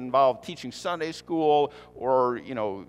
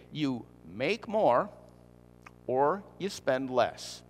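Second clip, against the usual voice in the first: slow, spaced-out phrases; mock-simple explanation; slower than usual. Shown as a demonstration. Preacher simplifying finances for the congregation, slow and deliberate. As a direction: spell it out slowly, pausing before the alternative. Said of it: "I know that came at you awful quickly".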